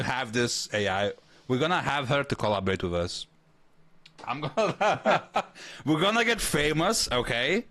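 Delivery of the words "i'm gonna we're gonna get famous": giggling through the words; laughing. Real, not acted. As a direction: laugh while you say it. Giddy about collaborating with the AI.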